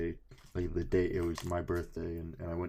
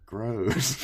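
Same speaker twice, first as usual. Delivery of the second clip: ordinary talk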